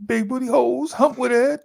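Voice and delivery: high-pitched quoting a film line; movie quote. Retold or imitated. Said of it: "that scene from Friday".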